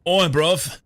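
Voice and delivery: loud, high North London "oi bruv"; cheeky shouted North London greeting; North London accent. Shown as a demonstration. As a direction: bark it like a North London lad. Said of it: "That's North London".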